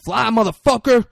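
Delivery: loud, high acted order; shouted command. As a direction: yell it at the pilot. Acted order at gunpoint to pilot.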